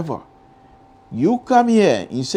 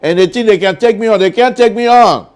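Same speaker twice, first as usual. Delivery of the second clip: excited; 6 semitones higher, 7 dB louder than usual. Real, not acted. Host defiant about rivals.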